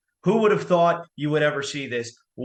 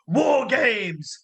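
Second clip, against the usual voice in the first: drawn-out hype; 7 semitones higher than usual. Acted. Hypes the event name.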